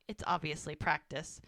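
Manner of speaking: ordinary talk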